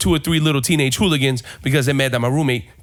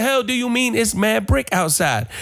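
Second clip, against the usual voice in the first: high-pitched comic indignation; exasperated confusion; 9 semitones higher than usual. Retold or imitated. Confronting his roommate about mad brick, exasperated and confused. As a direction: replay the baffled rant with raised pitch.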